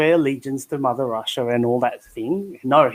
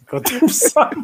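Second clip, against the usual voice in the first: nagging mum, pitched up; mock mum voice; 10 semitones higher, slower than usual.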